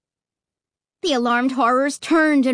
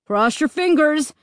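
raised, mild call; 4 semitones higher, 3 dB louder than usual. A narrator's reading. Dad calls to the family while leading the way. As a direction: call it over to the others. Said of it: "Dad called".

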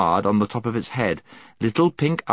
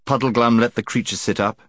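ordinary delivery

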